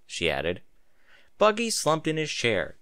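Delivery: plain reading voice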